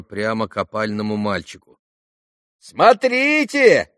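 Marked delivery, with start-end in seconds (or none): 2.6-3.9 very high, long-drawn call; warning shout. A narrator's reading. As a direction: holler after him in warning. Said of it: "кричали ему вслед предупредительно". (протяжный предостерегающий крик)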